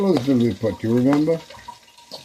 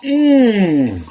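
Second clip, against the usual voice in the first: savoring mmm; 8 semitones higher, 4 dB louder than usual. Real, not acted. Tasting his own dish.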